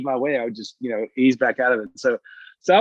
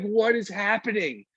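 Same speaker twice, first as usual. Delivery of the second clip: baffled; 8 semitones higher, 4 dB quieter, slower than usual. Retold or imitated. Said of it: "I was like".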